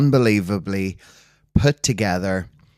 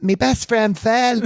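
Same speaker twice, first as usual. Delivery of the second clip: much higher, slow mock voice quoting her; imitating friend's mum; 13 semitones higher than usual. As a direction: pipe it up high and slow like the proud mum. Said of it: "And she went".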